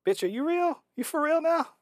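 very high-pitched mock mother's scolding; squeaky impression of an appalled mom; imitated incredulous mama. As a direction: question her in high disbelief, hammed up. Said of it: "her mama must have been like".

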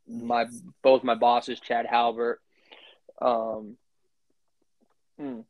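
spitting, mouth wet; pausing to spit mid-sentence; spitting while talking. Real, not acted. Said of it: "sorry, I'm spitting".

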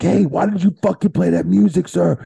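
high, loud telling-off; retold angry demand. Referee scolds the music man over the headset, angry.

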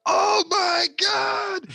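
very high, loud, drawn-out wail; shocked yell. Retold or imitated. Co-worker sees the tea in the fish, shocked yell. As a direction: cry it out in exaggerated disbelief.

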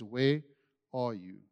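very soft, gentle asking, almost under the breath; tender call. Acted. God softly calls to the hiding man, tender and quiet. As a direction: ask it softly, like a father searching for a child. He asked this beautiful question.

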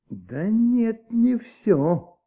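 sudden realization. Acted. Detective suddenly realizes a gap.

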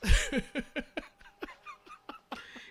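loud high laughter. Real, not acted. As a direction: burst out laughing. Big laugh.

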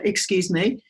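normal talking voice

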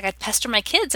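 ordinary delivery

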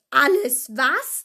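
slow, loud, outraged question; booming incredulous roar; thundering. A narrator's reading. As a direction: thunder it out, drawing out each word. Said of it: "donnerte Hagrid".

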